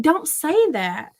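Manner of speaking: high, slow, stern telling-off; retold scolding. Mother correcting son's language, slowly scolding.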